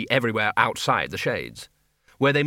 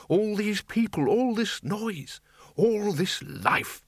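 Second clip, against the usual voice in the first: put-on awed old wizard; 8 semitones higher, slower than usual. Windle marvels at the Shades.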